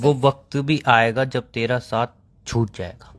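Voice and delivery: slow, dramatic couplet recitation, dropping low at the end; reciting shayari. Acted. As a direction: recite it like a heartbroken poet. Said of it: "तो शेरो शायरी क्यों बोलो बीच में".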